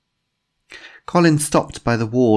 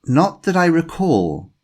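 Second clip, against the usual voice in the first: slow, careful denial, weighing each word; careful answer; 9 semitones higher, 5 dB louder, slower than usual. A narrator's reading. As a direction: answer slowly, guarding what you say. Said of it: "he answered carefully".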